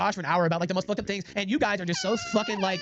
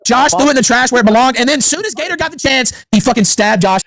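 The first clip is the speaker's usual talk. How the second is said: shouting; 14 dB louder than usual. Real, not acted. Ranting at rivals on stream.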